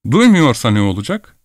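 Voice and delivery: ordinary delivery, nothing marked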